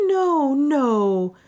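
high, drawn-out protest; pleading cry. A narrator's reading. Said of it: "cried Juana".

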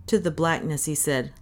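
usual speaking voice

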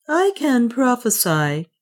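a weary prophetess; Juana. Acted. Juana to Otter, slow and resigned. Juana speaking, slower and higher.

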